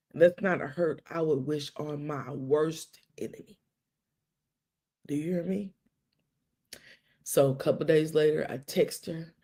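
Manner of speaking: ordinary talk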